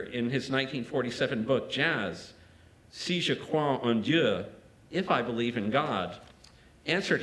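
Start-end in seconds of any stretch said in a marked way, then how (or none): 2.9-4.5 French quote. Real, not acted. Quoting Matisse in French.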